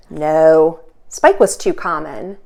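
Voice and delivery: ordinary delivery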